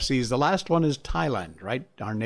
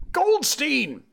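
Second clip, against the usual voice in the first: bellowed; 13 semitones higher than usual. A narrator's reading. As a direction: bellow the name. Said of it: "bellowed the boy".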